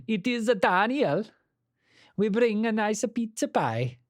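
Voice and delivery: high-pitched, hammed-up Italian caricature. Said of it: "stereotypical Italians".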